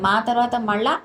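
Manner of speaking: usual speaking voice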